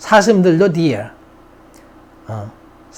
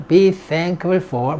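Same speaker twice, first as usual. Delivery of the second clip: "be thankful for" drawn out even slower and dropping in pitch; very slow, falling repeat; 3 dB quieter, much slower than usual. Shown as a demonstration. Teacher finishing a drill for students, very slow and falling. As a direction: settle the phrase down slowly at the end. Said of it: "repeat after me".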